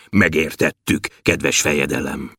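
deep, low dwarf voice; thick voice. A narrator's reading. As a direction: answer the ruler low and thick. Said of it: "mondta Bobolysza vastagon". (vastag, mély törpehang)

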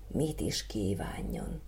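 slow, hushed whisper of a question; whispered musing. A narrator's reading. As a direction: whisper it as if thinking aloud. Third boy pondering.

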